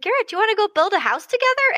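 put-on high, quick child asking brother to play; high fast eager kid voice. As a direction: chirp it like an eager little boy. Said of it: "I will hear Grant be like".

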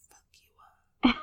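a barely audible mouthed threat; mouthed silently. Retold or imitated. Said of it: "looking at him like this".

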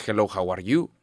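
put-on English with a light Mexican accent, "Hello" said with a rough h like "jelo". A Mexican speaking English at a meeting, in a light Mexican accent. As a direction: say "Hello, how are you?" the way a Mexican would. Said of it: "tenemos el acento mexa".

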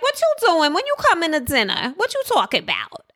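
high-pitched mock New Jersey accent. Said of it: "never had the New Jersey accent".

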